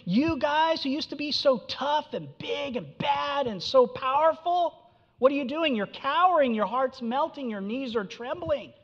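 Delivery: high sneering jeer at the once-mighty; preacher voicing the prophet scornfully taunting Nineveh, high-pitched; mocking taunt. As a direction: taunt them in a high voice, savoring their fear. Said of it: "He's mocking them".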